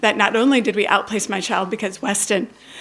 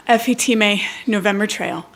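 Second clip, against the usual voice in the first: ordinary talk, nothing marked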